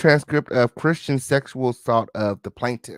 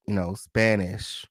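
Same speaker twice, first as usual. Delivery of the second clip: ordinary talk, nothing marked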